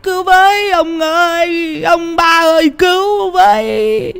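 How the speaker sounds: high, drawn-out panicked wail; slow, very high-pitched cries of alarm; crying out loudly. Acted. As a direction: scream for help as a terrified old woman. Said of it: "bà ấy la lớn".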